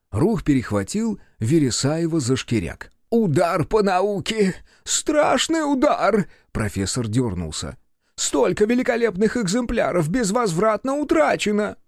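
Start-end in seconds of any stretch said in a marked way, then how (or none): 3.1-6.3 high, slow anguished lament; distraught professor. A narrator's reading. Professor mourning his lost specimens, distraught. Профессор горюет о сгоревших образцах. 8.2-11.8 put-on high-pitched grieving old scholar; distraught professor. A narrator's reading. Professor mourning burnt specimens, distraught. Профессор горюет о сгоревших образцах.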